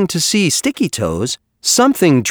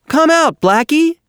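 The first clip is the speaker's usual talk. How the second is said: high, calling-out voice; shouted call; 14 semitones higher than usual. A narrator's reading. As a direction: call out to someone hidden away. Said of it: "they all shouted".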